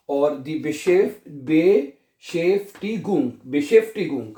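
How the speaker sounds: very slow Beschäftigung broken into parts; syllable-split slow model. Shown as a demonstration. Teacher breaking a long word into slow parts. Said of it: "क्या बनेगा लफ्ज?".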